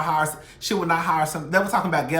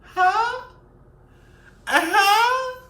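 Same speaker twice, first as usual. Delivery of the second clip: much higher, louder sassy huh; exaggerated mock reaction; 7 dB louder, much slower than usual. Real, not acted. Vlogger reacting to viewers, much higher sassy squeal.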